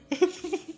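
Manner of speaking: quick high giggle. Real, not acted. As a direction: giggle at your own joke. Laughs at his own bit.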